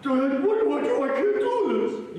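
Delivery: acted emotional despair; distressed plea. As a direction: beg for help, pitch climbing. Acted plea to dad in crisis.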